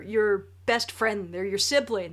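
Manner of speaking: usual speaking voice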